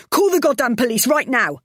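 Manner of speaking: high, loud furious order; furious demand. Acted. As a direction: demand it in rage. Wife demanding in a row.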